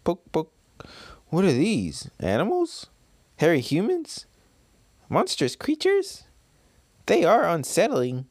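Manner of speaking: put-on high, curious child's voice; little kid voice. Baby Kamamatsu poking at creatures on the beach, high and curious. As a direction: poke at strangers like a wide-eyed kid. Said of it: "little baby Kamamatsu".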